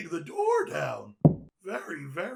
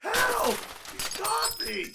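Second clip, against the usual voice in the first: terrified scream. Acted. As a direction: scream for help in panic. Kid grabbed by villain.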